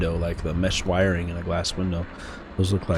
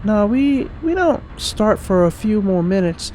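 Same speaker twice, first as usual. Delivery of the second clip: higher-pitched game character voice; [name]; 5 dB louder than usual. Acted. Station worker greeting an early visitor, lightly feminine voice. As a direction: lift the voice for a helpful station worker.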